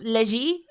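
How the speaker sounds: Léger said luh-ZHEE, French style; French pronunciation. Shown as a demonstration. Co-caster suggests a French reading of a name, French pronunciation. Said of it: "because it's French".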